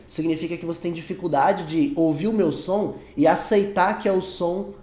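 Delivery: ordinary talk, nothing marked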